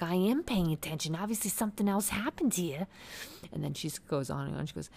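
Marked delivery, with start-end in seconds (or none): 0.0-2.9 high-pitched mock flip-out; Teresa. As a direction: act out an indignant comeback. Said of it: "Teresa fucking flips at this point".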